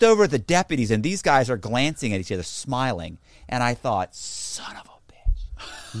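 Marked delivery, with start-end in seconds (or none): 4.2-6.0 slow, breathy whispered curse; realizing the cops think he's a prostitute, slow whisper. Retold or imitated. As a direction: mutter it under your breath, dismayed. Thought on realizing the trap.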